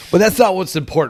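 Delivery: normal talking voice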